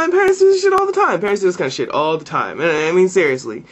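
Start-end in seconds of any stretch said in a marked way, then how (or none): 0.0-1.2 high, loud, fast outburst; blurting it out in exasperation; heated rant. Real, not acted. Ranting about parents.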